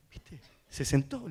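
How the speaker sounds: very soft confidential remark; barely voiced, low aside; hushed aside. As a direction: murmur it discreetly to the parent. Retold aside to the mother.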